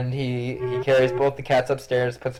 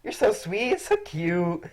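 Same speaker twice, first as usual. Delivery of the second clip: higher, cooing at the cat; cooing mother; 8 semitones higher, slower than usual. Acted. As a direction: coo over the sweet cat. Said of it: "She's like".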